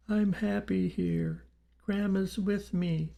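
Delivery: put-on high, slow little-boy ghost voice; frail, wavering child. A narrator's reading. Spirit boy comforting his mother, frail and wavering. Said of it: "the frail, wavering voice replied".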